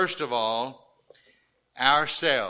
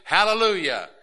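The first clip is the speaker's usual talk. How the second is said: raised, higher cry of hallelujah; shouting praise; 6 semitones higher, 5 dB louder than usual. Pretend. Preacher imagines congregation praising during the offering, shouting praise. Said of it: "we would start shouting praise".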